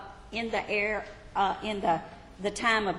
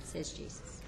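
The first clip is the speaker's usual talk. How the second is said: usual speaking voice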